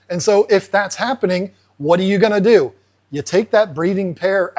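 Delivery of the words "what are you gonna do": ordinary talk, nothing marked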